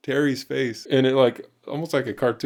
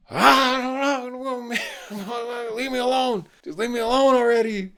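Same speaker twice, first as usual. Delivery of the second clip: very high squealing mock tantrum; angry kid; 14 semitones higher than usual. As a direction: wail it way up high like a kid melting down. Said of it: "angry on the bench".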